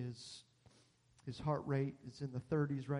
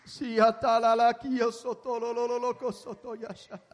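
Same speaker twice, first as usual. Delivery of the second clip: speaking in tongues; 7 semitones higher, 10 dB louder than usual. Real, not acted. Glossolalia in prayer.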